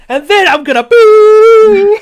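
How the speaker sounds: very high, stretched-out comic scream; hammed-up gamer rage; screaming profanity. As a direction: bellow the threat, holding the last word long. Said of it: "then I scream profanities at my computer".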